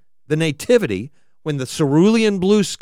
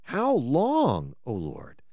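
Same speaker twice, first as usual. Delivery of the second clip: quieter echo of the cry, falling to almost nothing; softer lament; 7 dB quieter, much slower than usual. Acted. Pastor echoing the psalmist's plea, softer and fading. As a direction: repeat it gently, sinking at the end.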